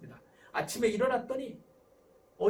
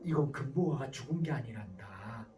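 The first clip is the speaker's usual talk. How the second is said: parent sweetly fibbing; 8 semitones lower than usual. Acted. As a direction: reassure a child with an absurd story. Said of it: "이런 부모들은 어떻게 합니까?". (아이를 달래듯 낮고 천천히)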